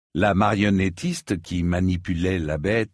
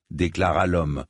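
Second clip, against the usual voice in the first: ordinary delivery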